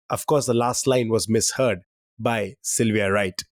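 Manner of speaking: ordinary talk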